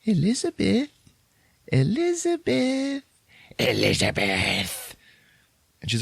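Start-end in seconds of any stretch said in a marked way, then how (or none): 0.0-3.0 high, drawn-out ghostly calling; eerie woman's voice. Retold or imitated. Dwarf calling Liz in Grandma's voice, high and eerie. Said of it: "it's a woman's voice". 3.6-5.0 slow, drawn-out monster rasp; creepy dwarf voice. Retold or imitated. Dwarf calling Liz, in a slow creepy rasp.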